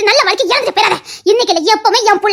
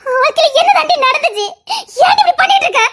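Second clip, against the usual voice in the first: higher, urgent; anxious raised demand; alarmed question; 6 semitones higher than usual. Acted. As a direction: ask in worried disbelief. Alarmed aunt demands answer.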